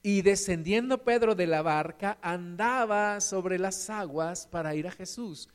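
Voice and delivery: ordinary talk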